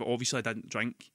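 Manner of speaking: ordinary delivery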